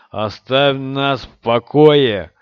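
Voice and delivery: slow, loud shouted warning. Acted. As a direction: bellow it slowly, each word hit. Rand's threat rises to shout.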